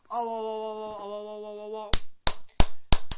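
loud mock sobbing ohs; mock crying. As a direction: wail like a crying fan. Fan crying.

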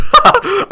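shrieking laugh, way up high; high burst of laughter. Real, not acted. Player laughs at an opponent's taunt, high shriek.

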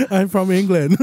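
jokey English accent between laughs; badly faked English accent; mock Pommy accent. Shown as a demonstration. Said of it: "Give us your best Pommy accent".

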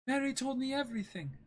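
put-on high, shaken young woman's voice; Kaya, upset girl. S1 voices Kaya reading manga.

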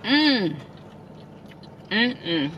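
chewing with mouth closed; humming through food; mouth full. Real, not acted. Seen 0: Bites burger, then chews with full closed mouth while humming.

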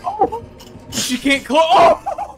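loud, high-pitched yells; excited shouts. Real, not acted. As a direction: yell it at the screen. Exclamations during a close chase.

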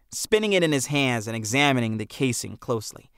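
plain reading voice

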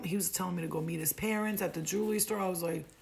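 ordinary talk

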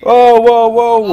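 startled shout. Real, not acted.

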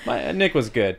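laughing through the words. Real, not acted. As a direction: talk while laughing. Laughs at own joke.

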